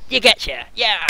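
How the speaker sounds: loud high cheer; excited shout. Acted. Orc cheers loudly.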